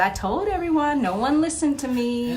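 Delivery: complaining Michael. Retold or imitated. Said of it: "And he said".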